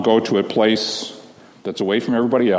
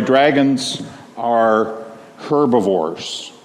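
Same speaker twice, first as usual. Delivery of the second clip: slow and deliberate, each word weighed; drawn-out teaching emphasis; much slower than usual. Shown as a demonstration. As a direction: lay it out slowly so the kids catch it. Stressing the story's key fact.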